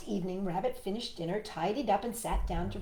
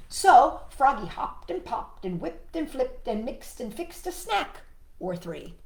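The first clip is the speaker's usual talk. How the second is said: ordinary delivery, nothing marked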